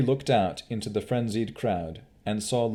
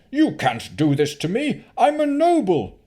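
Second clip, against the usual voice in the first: high, loud, outraged cry; captured Baron protesting; panicked protest; 13 semitones higher, 8 dB louder than usual. Acted. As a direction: struggle against the mob. Baron seized by mob.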